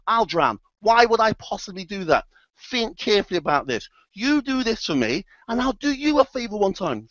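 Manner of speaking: mock-earnest sales pitch; insistent persuasion. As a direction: talk a reluctant friend round, pleading your case. Scorpion argues to persuade frog.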